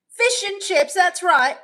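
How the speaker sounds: loud, high mock-British exclamation; British accent. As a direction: call it out in a put-on British accent. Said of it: "Her British accent is ten times better".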